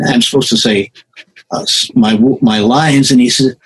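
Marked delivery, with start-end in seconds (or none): none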